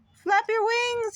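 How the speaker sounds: high, slow yell. A narrator's reading. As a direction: yell up at him to flap. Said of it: "yelled Missus Bird".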